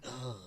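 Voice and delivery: low deflated groan, almost silent; groan of embarrassment. Retold or imitated. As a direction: let out a quiet embarrassed ugh. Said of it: "and you're like".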